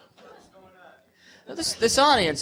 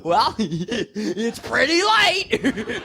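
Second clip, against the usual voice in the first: slow, giggling through the words; laughing answer; 3 semitones higher, much slower than usual. Pretend. To a stranger asking the time, slow laughing reply. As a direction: answer a stranger while cracking up.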